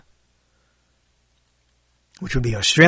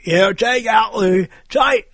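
high-pitched, badly faked Australian accent; failed Aussie accent; 7 semitones higher than usual. Shown as a demonstration. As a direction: try Aussie and fail at it. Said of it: "I don't know why I can't do Aussie".

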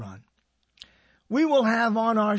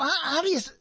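pitched-up, fumbling mock question; mock puzzled voice; 7 semitones higher, faster than usual. Host mimicking people who ask how to say his name, raised pitch. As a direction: stumble over the question like someone baffled by a name. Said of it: "they go".

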